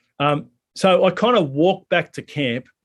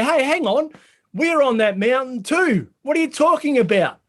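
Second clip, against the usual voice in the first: fired-up protest; 11 semitones higher than usual. Acted. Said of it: "James and John get fired up and they say".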